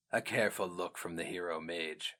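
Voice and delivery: ordinary delivery, nothing marked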